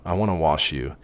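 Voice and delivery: ordinary delivery, nothing marked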